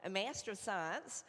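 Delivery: a light American accent on "a Master of Science"; playful American accent for the degree title; put-on American accent. Shown as a demonstration. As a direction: slip into a mild American accent. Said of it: "I have to put the accent on now".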